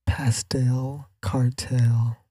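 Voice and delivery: slow, breathy whispered words; ASMR whisper. Pretend. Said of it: "Why is that satisfying?".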